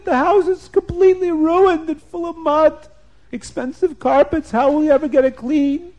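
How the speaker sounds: high-pitched, hammed-up wail of despair; crying. Storyteller as couple lamenting their ruined house, hammed-up crying. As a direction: wail it loud and high, climbing as the lament builds. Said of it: "start to cry".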